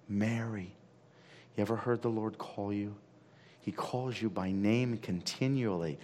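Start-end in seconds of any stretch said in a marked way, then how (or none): none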